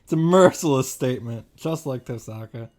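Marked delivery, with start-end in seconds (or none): none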